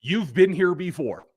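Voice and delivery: normal talking voice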